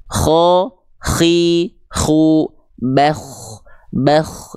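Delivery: "Kho, khi, khu, bakh" with a raspy fricative kh from the top of the throat. Shown as a demonstration. Teacher demonstrating the letter's articulation point, rasping breathy kh. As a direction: let breath scrape through the kh in each syllable. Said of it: "dari ujung tenggorokan".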